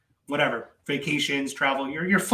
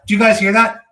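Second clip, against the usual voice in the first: excited callout; 5 semitones higher, 10 dB louder than usual. Real, not acted. Excited callout to viewers over clip.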